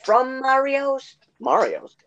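slow, high sing-song shop slogan; mock ad slogan. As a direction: stretch each phrase, drop on the last. Acted meat shop slogan.

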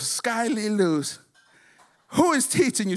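scolding mom. Retold or imitated. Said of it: "her mom goes".

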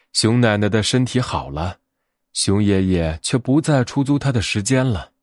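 usual speaking voice

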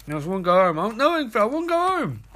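rising, whining plea; rising whiny plea to go home. Retold or imitated. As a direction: whine like a kid who wants to leave.

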